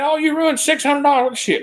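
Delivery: high, sneering take on the boss; mocking the boss. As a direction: mimic her in a snotty tone. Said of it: "she said".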